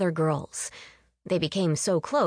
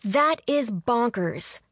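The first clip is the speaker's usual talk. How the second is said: slow, raised-pitch disbelief; incredulous; 5 semitones higher, slower than usual. A narrator's reading. Hungry fan still protesting at the TV, incredulous. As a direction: lean on each word, appalled at the call.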